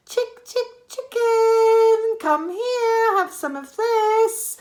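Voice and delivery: high sing-song coaxing; high boy's voice coaxing a hen. A narrator's reading. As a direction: lure the greedy hen closer. George coaxes the hen.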